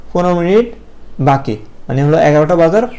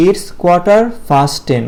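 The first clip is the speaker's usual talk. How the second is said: slow model phrase; 2 semitones higher, much slower than usual. Shown as a demonstration. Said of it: "কি বলবো?".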